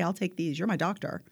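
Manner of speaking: ordinary talk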